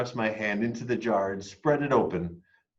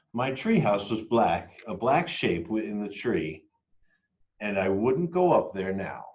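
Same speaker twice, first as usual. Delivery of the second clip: plain reading voice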